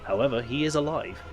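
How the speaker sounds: quiet, even narrator read; story narration. A narrator's reading. Reads game narration.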